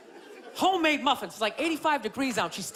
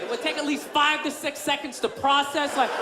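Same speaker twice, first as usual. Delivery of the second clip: ordinary delivery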